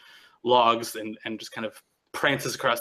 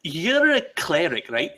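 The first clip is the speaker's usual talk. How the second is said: high, quick, eager stammer; eager stammering questioner; 6 semitones higher, 4 dB louder than usual. Acted. Nyctis eagerly pestering Hargath the cleric at lunch. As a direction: pester him with a question he can't hold back.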